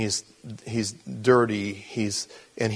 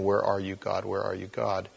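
quiet, hushed, despairing cry to God; a lost man's quiet prayer; desperate plea; 3 semitones lower, 6 dB quieter than usual. Retold or imitated. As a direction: plead softly, as if at the end of your rope. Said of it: "a lot of days just flipping out".

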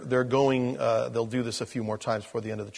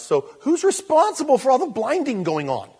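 rhetorical challenge; 11 semitones higher, 8 dB louder than usual. Acted.

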